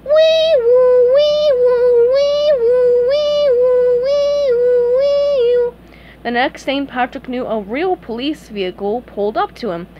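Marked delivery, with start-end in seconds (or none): 0.0-5.7 very high, loud wailing siren; siren imitation. SpongeBob imitates a siren as he leaves, wailing. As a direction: wail up and down like a siren. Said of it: "his best imitation of a siren".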